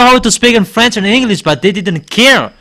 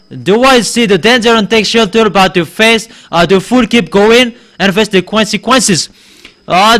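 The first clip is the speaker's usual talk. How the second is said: normal talking voice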